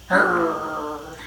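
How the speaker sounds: drawn-out rolling growl; dog growl. A narrator's reading. Dog answers Rat's greeting, growling. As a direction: growl at Rat. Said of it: "Dog growled at Rat".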